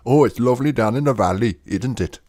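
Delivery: quick lilting Welsh impression; Welsh accent. Shown as a demonstration. Londoner showing how Wales sounds, put-on Welsh accent. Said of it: "And then there's Wales".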